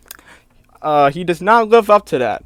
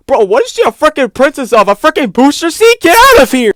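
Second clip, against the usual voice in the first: rising higher and louder to a shout; incredulous rant; 9 semitones higher, 5 dB louder than usual. Real, not acted. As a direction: build disbelief to an outburst.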